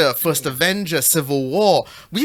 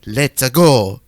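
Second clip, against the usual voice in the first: cartoon Italian catchphrase, punched louder; Italian accent; 4 semitones lower, 5 dB louder than usual. Shown as a demonstration. Showing how Mario's catchphrases should go, Italian accent. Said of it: "catchphrases in an Italian accent".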